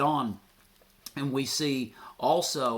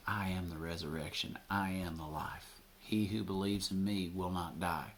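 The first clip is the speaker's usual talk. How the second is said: low, soft and intimate, falling to a whisper; quiet solemn declaration; hushed Jesus voice; 5 semitones lower, 6 dB quieter than usual. Acted. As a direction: speak it like a secret promise. Jesus voiced softly, ending whispered.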